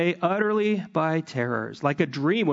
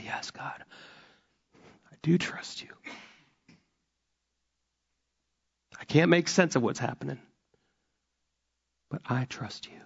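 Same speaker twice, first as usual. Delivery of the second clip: whispered prayer; 2 semitones lower, 6 dB quieter than usual. Pretend. Voiced prayer of trust.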